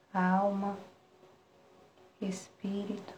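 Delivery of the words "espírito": breathy whispered word. Acted. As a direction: whisper it softly. Single word within meditation.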